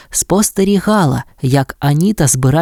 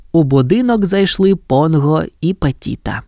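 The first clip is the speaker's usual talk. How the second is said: usual speaking voice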